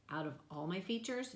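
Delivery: usual speaking voice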